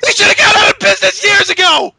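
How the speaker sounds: outraged, strained hollering; exasperated rant. Real, not acted. Reviewer raging at the wasted money, exasperated rant. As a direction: vent like someone at breaking point.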